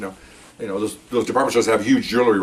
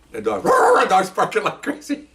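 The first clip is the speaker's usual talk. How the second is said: laughing; 9 semitones higher, slower than usual. Real, not acted. Laughing while telling the story.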